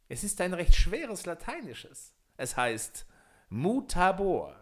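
high, loud, slow sorcerer voice; gloating magician drawing out Mutabor; boastful wizard. Acted. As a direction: savor the secret word slowly. Wizard reveals the magic word.

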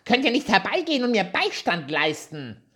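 high, loud angry little dwarf; shrieking dwarf. A narrator's reading. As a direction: screech at the girls from the trapped beard. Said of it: "und schrie".

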